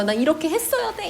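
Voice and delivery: high, a touch of acted resentment; mock indignant outburst. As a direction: lightly voice Mongkyu's bitter regret. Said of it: "이러면서 막 울분에 연기를 하면서". (울분을 살짝 흉내 내며)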